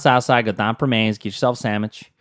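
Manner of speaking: casual Pittsburghese, flattened vowels; Pittsburgh accent. Shown as a demonstration. Recommending a local sandwich shop, Pittsburgh accent. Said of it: "hear people talk like that".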